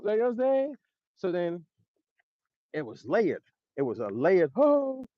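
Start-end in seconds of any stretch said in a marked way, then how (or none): none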